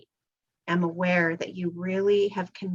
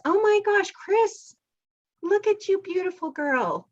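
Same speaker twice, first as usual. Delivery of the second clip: gushing admiration; 10 semitones higher than usual. Real, not acted. Host greeting a child in costume, gushing.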